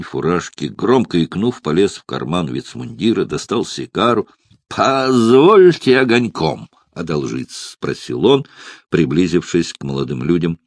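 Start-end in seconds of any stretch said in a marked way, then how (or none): 4.7-7.7 put-on slow, light Baltic German accent; a hint of a Baltic accent. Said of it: "послышался лёгкий акцент, не то остзейский, не то чухонский".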